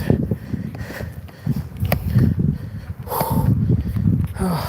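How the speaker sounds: long breathless huff; heavy huffing exhale after a workout; huffing and puffing. Real, not acted. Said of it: "Sorry for the huffing and puffing".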